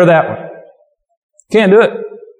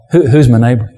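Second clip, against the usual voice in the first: mocking sneer; 5 semitones lower than usual. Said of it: "It's like".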